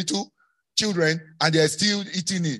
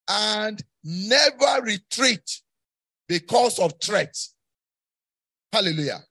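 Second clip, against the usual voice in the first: slow, drawn-out exhortation; forceful preaching; 2 semitones higher, slower than usual. Real, not acted. Preacher urges congregation never to retreat, forceful and deliberate. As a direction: hammer out each phrase for the crowd. Preaches a charge to move forward.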